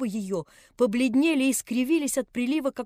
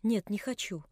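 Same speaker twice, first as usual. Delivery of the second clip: quiet refusal; 2 semitones lower, 7 dB quieter than usual. A narrator's reading. Nelli refusing the old man, face in pillows, quiet. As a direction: mutter no into the pillow. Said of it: "отвечала Нелли, не подымая головы".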